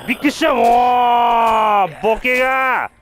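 loud, high furious yelling; angry shouting. Real, not acted. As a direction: yell in shock and anger. Angry after jump scare.

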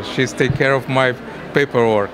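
ordinary talk, nothing marked

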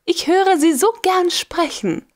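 high, sweet, teasing lady's voice; coy, lilting mock-sweetness; coy princess. A narrator's reading. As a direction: purr it up high like a flirt. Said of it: "lispelte Lubina".

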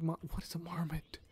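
whispered, puzzled reading. Real, not acted. Gamer puzzling over a creature's name, whispering. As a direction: breathe it out under your breath.

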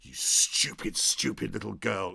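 a hint of a bullying producer; nasty threat. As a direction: belittle her with contempt. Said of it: "said the immortal lines".

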